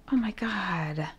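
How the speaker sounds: barely voiced oh my God; awed whisper. Real, not acted. Finding the artist's handmade card, whispering in awe. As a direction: whisper it, moved.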